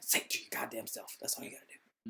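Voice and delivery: soft unvoiced whisper. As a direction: whisper it to yourself. Advising to say it quietly.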